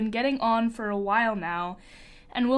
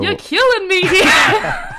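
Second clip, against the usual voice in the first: high, loud laughing cry; laughing shout; 11 semitones higher, 10 dB louder, slower than usual. Real, not acted. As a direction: shout it while cracking up. Cracking up at the innuendo.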